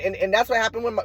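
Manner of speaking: ordinary talk, nothing marked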